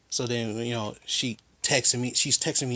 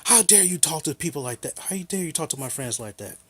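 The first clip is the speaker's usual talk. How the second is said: higher-pitched, scolding mock outrage; retold indignation; 5 semitones higher than usual. She calls back about how he talked to her friends, indignant. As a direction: imitate her indignant telling-off.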